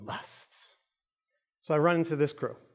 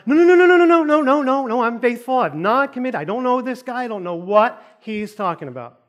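high, loud hammed-up panicked denial; freaking out; 7 semitones higher, 5 dB louder than usual. Accused husband denying it before his wife, hammed-up panic. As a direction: burst out in rapid-fire no's, then protest. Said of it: "freaking out now".

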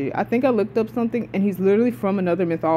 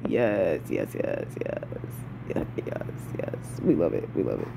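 hushed, lower, breathy yeses; whispering; 8 dB quieter than usual. Real, not acted. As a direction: whisper it like a secret delight. Gloating over the character.

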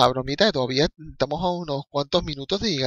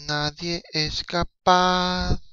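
very slow, stretched-out words; dragged-out lament; 4 dB quieter, much slower than usual. Pretend. Fan complaining nobody scores, very slow drawn lament. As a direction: let each word sag.

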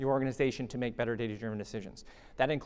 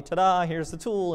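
higher, louder showy reveal, drawn out; jokey ta-da; 5 semitones higher, 5 dB louder than usual. Acted. Presenter parodies just unveiling a new tool, jokey ta-da. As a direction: announce it with a mock flourish. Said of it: "what I jokingly call the ta-da".

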